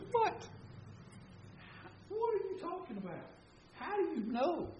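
high, startled questions tumbling out; shocked disbelief. Retold or imitated. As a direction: sound floored by the news. Shocked reaction to affair news.

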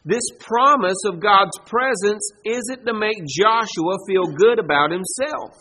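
ordinary delivery

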